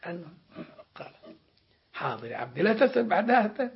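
ordinary delivery

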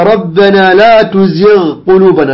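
slow recitation, ghayn of تزغ held distinct before the qaf; unmerged تزغ قلوبنا; clear ghayn. Shown as a demonstration. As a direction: sound the gh of تزغ fully, then قلوبنا. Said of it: "إظهار الغين مع القاف".

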